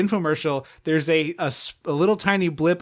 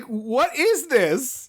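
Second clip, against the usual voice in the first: drawn-out, raised-pitch bafflement; baffled disbelief; 7 semitones higher, much slower than usual. Acted. Podcaster reacting to a sappy film scene, baffled. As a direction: stretch it out in exaggerated disbelief.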